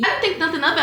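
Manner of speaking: ordinary talk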